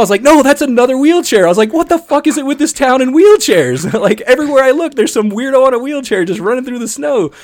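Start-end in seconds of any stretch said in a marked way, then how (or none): 0.2-1.5 high, loud retold protest; exasperated correction. Driver correcting friends about a second wheelchair, exasperated. 1.7-7.3 very high, loud retold outburst; exasperated rant. Driver ranting to friends about wheelchairs everywhere, exasperated.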